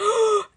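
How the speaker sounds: loud, high gasp, acted out; shocked gasp. Imitating viewers hit by the video, shocked gasp.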